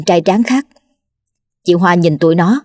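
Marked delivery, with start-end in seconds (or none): none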